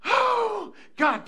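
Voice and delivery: high, loud heartfelt cry; mock jubilation. Grandpa hears they're boarding the plane, loud high relief. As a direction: exaggerate desperate relief. Exaggerated relief at daughter's call.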